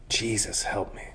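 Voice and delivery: soft, hushed plea for help; desperate prayer. Pretend. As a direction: whisper it like a last prayer. Voicing a desperate quiet prayer.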